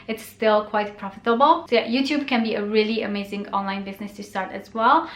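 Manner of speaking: ordinary talk, nothing marked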